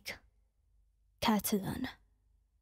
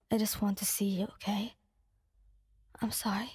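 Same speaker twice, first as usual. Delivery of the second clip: whispering. Acted. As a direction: whisper it gently, pleading. Coaxing friend outside the door.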